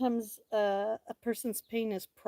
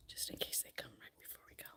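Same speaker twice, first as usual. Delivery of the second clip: fast hushed whisper; 22 dB quieter, faster than usual. Real, not acted. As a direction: whisper quickly. Whispering aside.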